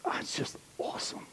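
breathless whispered awe; awed whisper. Real, not acted. Pastor marveling at God's timing, whispered. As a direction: let it out on a hush. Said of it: "whisper heard".

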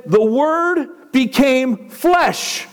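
"The Word became flesh" drawn out very slowly, each word weighed; "The Word became flesh" spoken slowly and solemnly, with a falling end on "flesh"; slow solemn reading. Shown as a demonstration. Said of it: "Then it said".

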